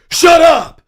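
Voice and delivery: sudden high yell; loud shout at the brother, half asleep. Retold or imitated. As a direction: snap awake and yell it. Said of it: "woke up ... yelling at Steven".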